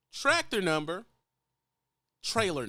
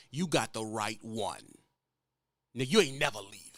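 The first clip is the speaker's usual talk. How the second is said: her inner voice; 7 semitones lower than usual. Retold or imitated. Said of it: "she's thinking".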